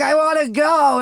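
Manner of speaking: pleading with his uncle to leave the mall, whiny; whiny plea. Retold or imitated. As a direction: beg to go home like a worn-out kid. Detox misery, begging to leave mall.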